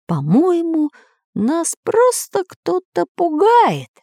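very high, slow character voice; squeaky professor; cartoon professor. A narrator's reading. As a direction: reason aloud in a high, drawn-out voice. Professor character doubts the ghost.

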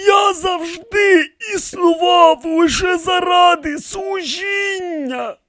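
very high, slow, drawn-out plea; pleading declaration. A narrator's reading. Kneeling priest pleads his service to ancestors, imploring. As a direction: stretch it out and fade on the last word.

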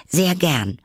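high, bright-toned eager reply; polite Alice. Acted. Alice accepting the turtle's offer, high eager voice. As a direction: lift the voice well up, keen.

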